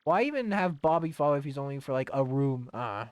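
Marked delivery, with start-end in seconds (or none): none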